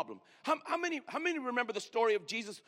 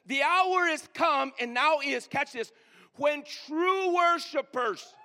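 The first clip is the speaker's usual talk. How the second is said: slow, raised proclamation; scripture recitation; 3 semitones higher, 3 dB louder, slower than usual. Acted. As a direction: drag each word of the verse.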